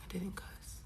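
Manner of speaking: whispered, breathless. Real, not acted. Insisting to viewers she held back, whispered. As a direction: whisper it to yourself. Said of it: "repeats in a whisper".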